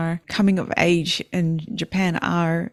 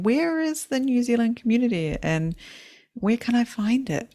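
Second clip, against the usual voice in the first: eager asker; 7 semitones higher than usual. Retold or imitated. Retold people asking eagerly.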